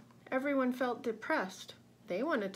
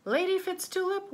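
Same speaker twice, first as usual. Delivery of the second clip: urgent call; 10 semitones higher, 6 dB louder than usual. A narrator's reading.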